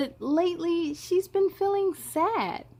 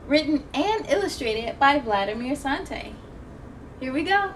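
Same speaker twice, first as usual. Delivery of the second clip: usual speaking voice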